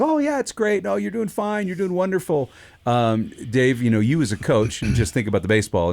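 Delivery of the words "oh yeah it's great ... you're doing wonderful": much higher, sugary fake praise, overdone; mock flattery. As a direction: gush like an empty flatterer, way up high. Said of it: "but when we're just flattering".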